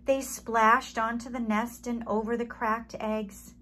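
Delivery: ordinary delivery, nothing marked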